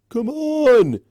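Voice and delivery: high, loud heckle; calling out. Acted. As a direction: shout it from the crowd. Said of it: "a random pony in the crowd called".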